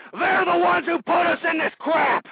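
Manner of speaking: high, loud, furious; angry shout. Real, not acted. Talk host ranting at his audience, angry shout. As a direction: blast the corporations.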